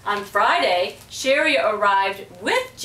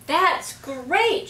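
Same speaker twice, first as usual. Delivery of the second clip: excited shout; 7 semitones higher than usual. A narrator's reading. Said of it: "shouted Matthew".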